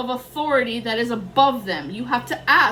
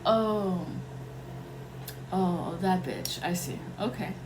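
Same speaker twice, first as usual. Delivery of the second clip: dry quiet retort; 5 semitones lower, 9 dB quieter than usual. Retold or imitated. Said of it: "and I was like".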